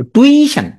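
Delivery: টিউশন said as "tui ... shan", high first part; spelling pronunciation. Shown as a demonstration. Teacher helping students keep the i, slow split টিউশন. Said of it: "আর ভুল হবে না, হ্যাঁ?".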